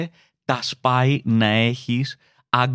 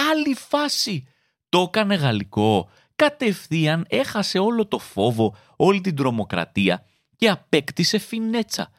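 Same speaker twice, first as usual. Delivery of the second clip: ordinary delivery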